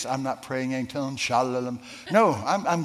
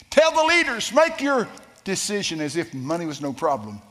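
high, loud shout that eases down; proclaimed command; 4 semitones higher, 5 dB louder than usual. Retold or imitated. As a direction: thunder out the opening, then settle. Repeats the Lord's message forcefully.

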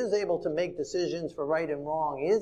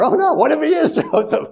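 much higher, louder, nervous-laughing panic; dawning dread; 13 semitones higher, 11 dB louder than usual. Retold or imitated. Brother realizes the ruler may truly be Joseph, nervous mock panic. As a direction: blurt it with a frightened laugh.

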